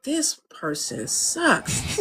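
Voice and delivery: slow, soft, deadpan put-down; unimpressed inner verdict. Retold or imitated. Engineer silently judging a client in the studio, slow deadpan. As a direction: mutter it under your breath, slowly.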